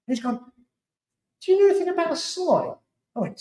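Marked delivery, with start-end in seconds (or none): 1.4-2.7 high questioning voice. Retold or imitated. Imitating the friend on the phone, high pitched question. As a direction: voice jumps way up, retold friend's question.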